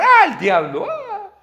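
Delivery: high, loud mock shriek; imitated possessed scream. Imitating an oppressed person screaming at the congress, mock scream. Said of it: "comenzó a gritar".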